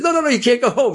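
loud, high no no no; insistent shout. Acted. Kid insists the men stay.

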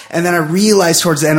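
ordinary talk, nothing marked

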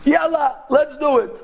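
high, eager agreement; acted glee, pitch up high; gleeful. Taxi driver thinks he scored easy money, gleeful. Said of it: "he's laughing his head off".

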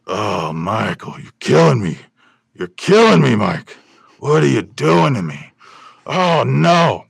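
loud, high, strained gravel-voiced lament; anguished Pacino-style outburst; Al Pacino impression. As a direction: wail it like a tortured movie star. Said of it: "Not easy to talk that way".

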